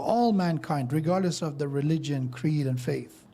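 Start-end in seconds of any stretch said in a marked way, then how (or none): none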